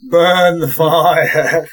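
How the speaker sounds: dragged out very slowly. Shown as a demonstration. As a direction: drag it out word by word. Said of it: "talk slower".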